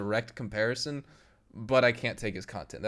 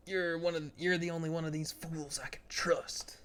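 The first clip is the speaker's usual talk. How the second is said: acted character voice; 5 semitones higher, 6 dB quieter than usual. Sudden acted game-character line.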